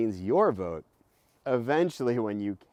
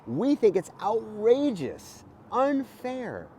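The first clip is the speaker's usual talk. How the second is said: rising, louder outrage; indignant; 10 semitones higher, 6 dB louder, slower than usual. Acted. Teacher reading to kids, indignant and louder. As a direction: sound outraged at the unfairness. Book voices indignation at injustice.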